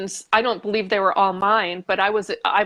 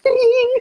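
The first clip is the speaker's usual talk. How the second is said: much higher, louder bright ding of realisation; bell ding; 7 dB louder than usual. Retold or imitated. Realising she's an emotional sponge, high loud ding. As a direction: ring the bell of insight. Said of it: "And I'm like".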